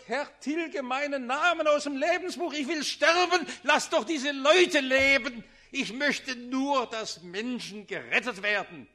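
loud, high desperate plea for the people; anguished intercession, rising to a shout; pleading cry. Acted. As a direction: cry out to God, begging to die in their place.

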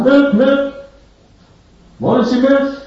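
high, loud sing-song milk seller's cry; milkmaid calling. Acted. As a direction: call out milk like a young milkmaid. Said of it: "da kommt eine Milchmädchen". (hohe, laute Milchmädchen-Stimme, die Milch anbietet)

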